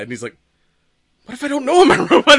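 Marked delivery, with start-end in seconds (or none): 1.3-2.4 high, loud, rushed acted freak-out; mock panic. Loki suddenly doubts he is human, mock panic. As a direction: blurt it in comic alarm, half laughing. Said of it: "This is, like, the panic of".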